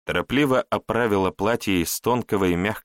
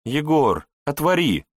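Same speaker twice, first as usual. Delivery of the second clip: higher voice calling through a door; pleading call; 8 semitones higher, 4 dB louder than usual. Acted. Wife calls husband through a locked door, pleading. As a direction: beg him to open, lightly raised.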